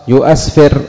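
يؤسفر as "yu-asfir", hamza sounded; hamza kept in يؤسفر. Shown as a demonstration. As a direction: keep a glottal "a" after "yu" in يؤسفر. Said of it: "لم يحذف شيء". (يؤسفر بإثبات الهمزة مفتوحة بعد الياء)

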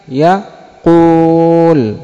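يقول said very slowly with a long ū; slow drawn-out yaqūl. Shown as a demonstration. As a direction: stretch يقول out. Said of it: "الثاني ماذا؟". (يقول ببطء شديد مع المد)

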